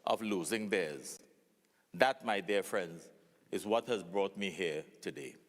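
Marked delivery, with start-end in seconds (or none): none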